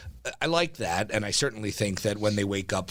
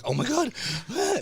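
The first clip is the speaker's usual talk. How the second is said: mock shock. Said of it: "They're like".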